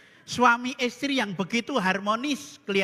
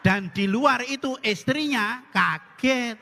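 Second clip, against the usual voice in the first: ordinary talk, nothing marked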